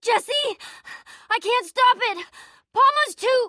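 high, strained cry; panicked pain. Acted. Possessed friend begs Jesse for help, panicked and pained. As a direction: push through pain, rising in panic.

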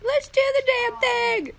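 high-pitched cutesy catchphrase quote; Becca's catchphrase. Retold or imitated. Viewer echoing a catchphrase she likes, in a high cutesy voice. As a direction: squeal it like a slogan. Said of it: "Becca has a catchphrase".

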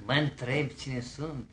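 low, soft theatrical musing; subdued, self-important wondering; hushed pondering. Acted. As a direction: echo the question softly, as if to yourself. Suitor muses on her question.

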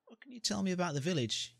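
high, inquiring question; hero asking. Acted. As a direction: ask the creature about the village. Hero asks the creature.

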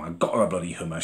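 ordinary talk, nothing marked

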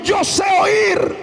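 high, slow shout; declare it. Shown as a demonstration. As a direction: proclaim it to your neighbour. (proclamación gritada)

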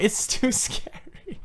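unvoiced, slow, breathy fright; real frightened whisper; scared whisper. Real, not acted. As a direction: breathe it out, too scared to speak up. Frightened by game in headset.

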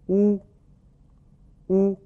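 乌 as French ou, lips pushed out round, tongue drawn back; rounded French vowel. Shown as a demonstration. Teacher models the last vowel, 乌 tightly rounded like French ou. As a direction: say 乌 with a small round opening. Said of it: "双唇要突出成圆形".

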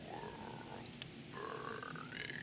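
slow, low, drawn-out whisper; very soft, entranced, stretched-out words; hushed awe. Acted. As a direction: murmur it slowly, lost in the moment. Awed echo while watching sunset.

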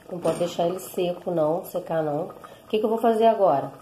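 ordinary delivery

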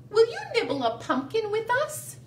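high, quick little mouse. Acted. Teacher voicing Mouse inviting Squirrel, high and fast. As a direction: ask like an eager tiny mouse. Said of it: "said Mouse".

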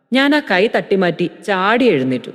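ordinary delivery

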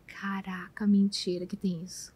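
soft whispered amazement; whispered disbelief. Retold or imitated. Mother hearing friend on teen parenting, whispered disbelief. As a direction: hush it as if stunned.

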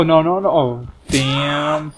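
ordinary delivery

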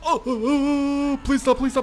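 high, loud crying plea; mock panicked pleading. Gamer begging the flood to stop, mock panicked cry. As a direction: beg the game to stop in mock terror. Hulk flailing in flood water.

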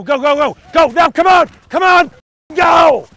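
loud, urgent yelling; coach shout. Real, not acted. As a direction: push him to go, full voice. Coach urging player during drill.

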